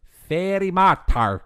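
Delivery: high, loud, drawn-out mock-Scottish accent; wildly mangled Scottish accent, pitched high; mangled movie accent. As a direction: mimic a bad Scottish-Irish accent, slow and loud. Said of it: "the way they said it was like".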